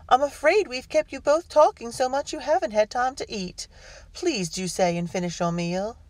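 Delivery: high, polite hostess voice; insistent hostess. A narrator's reading. Hostess urges twins to finish their meal, high polite insistence. Hostess presses guests to stay.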